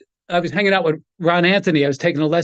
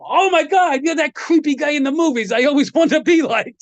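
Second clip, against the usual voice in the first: starstruck gush; 8 semitones higher than usual. Retold or imitated. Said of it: "I look at him and go".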